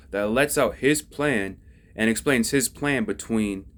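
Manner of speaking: normal talking voice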